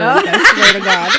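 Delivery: laughing high and loud through it; squealing with laughter; a burst of shrieking laughter. Real, not acted. Laughing at Whoopi comparison.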